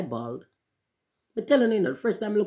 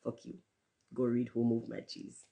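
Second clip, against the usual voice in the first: quiet self-rebuke, much softer; said to oneself in the mirror; 6 semitones lower, 10 dB quieter than usual. Retold or imitated. Facing the mirror, telling himself off quietly. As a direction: turn the insult on yourself, hushed. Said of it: "hold up the mirror and say it again".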